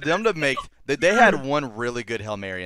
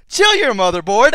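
louder and higher snappy retort; mock robot retort; 9 semitones higher, 9 dB louder than usual. Robot telling the other robot to chill, louder and higher. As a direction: snap it back like the robot.

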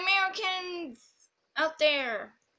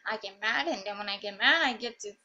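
ordinary talk, nothing marked

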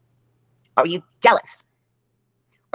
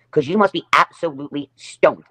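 usual speaking voice

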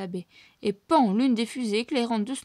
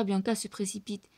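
ordinary delivery, nothing marked

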